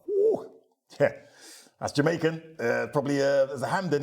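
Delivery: high, loud delighted whoa on the sniff; impressed whoa. Retold or imitated. Ian sniffing a rum at a festival, high excited whoa. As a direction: show the thrill of recognising it.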